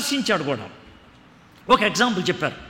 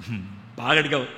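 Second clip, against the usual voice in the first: God's voice; 8 semitones lower, 4 dB quieter, faster than usual. Acted. God answers Solomon.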